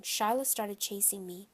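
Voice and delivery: ordinary delivery, nothing marked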